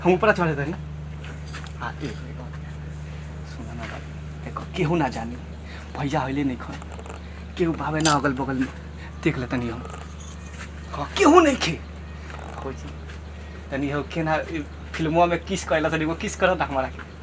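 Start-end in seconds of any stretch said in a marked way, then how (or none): 1.8-11.8 very soft, low conspiratorial murmur; furtive hush. Acted. Villager checks nobody is nearby, secretive and hushed. 12.6-17.1 low, soft furtive request; hushed wheedling. Acted. Villager shyly asks sister-in-law for a kiss, hushed.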